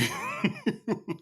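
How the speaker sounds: soft, voiceless wheeze of laughter; wheezy laughter. Real, not acted. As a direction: laugh almost without voice. Laughs at viewer's comment.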